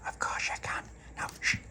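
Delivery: breathy secret whisper. Acted. Dolores whispering back to Charlotte so the others won't hear.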